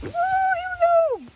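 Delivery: high drawn-out whoop; slow, high, excited woo; woo yell. Real, not acted. Rain arrives, cheering.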